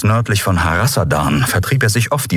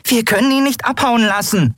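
much higher character voice; urgent crew member. A narrator's reading. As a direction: insist urgently in a high voice. Crew member urges captain to pursue. (hohe, drängende Figurenstimme)

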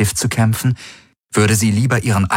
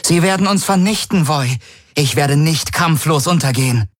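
defiant queen voice; 8 semitones higher than usual. A narrator's reading. Ylana defies the old seer.

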